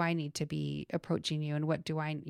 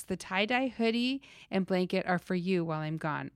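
ordinary delivery

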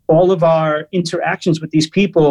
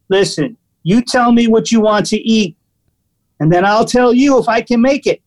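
imitated pushy waiter, pitch rising steadily; super aggressive waiter; 5 semitones higher than usual. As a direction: lay down the rules like a bossy waiter. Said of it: "Really super aggressive".

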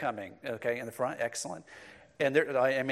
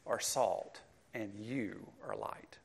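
whisper; 5 semitones lower, 11 dB quieter than usual. Real, not acted. Whispered half of quoted verse.

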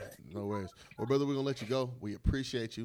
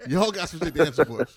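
laughing; 6 semitones higher, 9 dB louder than usual. Real, not acted. Teasing guest from Tacoma, laughing.

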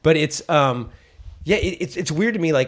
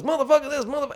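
Richard Pryor riff; 10 semitones higher, 3 dB quieter, faster than usual. Retold or imitated. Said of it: "every other word was".

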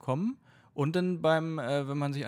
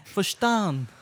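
lightly put-on Dutch flight attendant accent; stern Dutch stewardess, lightly voiced. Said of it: "jetzt sagst du was Holländisch".

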